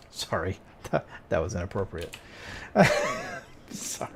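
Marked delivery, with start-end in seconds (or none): 2.2-3.6 sudden high unvoiced giggle; breathy embarrassed giggle; high breathy laugh. Real, not acted. Laughing at own outburst.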